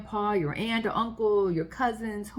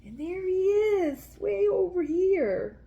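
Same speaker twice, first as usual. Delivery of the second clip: excited pointing; 11 semitones higher, slower than usual. Real, not acted. Excited pointing at pirate picture.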